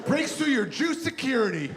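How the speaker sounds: milk each word like a big scandal; hammed-up outrage. Comic telling the crowd about the rogue goy, hammed-up high outrage.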